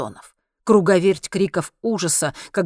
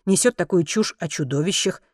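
ordinary delivery

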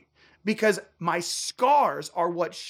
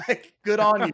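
laughing; 3 semitones higher, 4 dB louder than usual. Real, not acted. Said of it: "laugh heard on line".

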